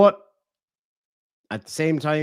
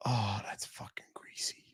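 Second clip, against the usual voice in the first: disgusted whisper; 3 semitones lower, 14 dB quieter than usual. Real, not acted. Reacting to clip.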